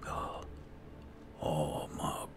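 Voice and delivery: ordinary talk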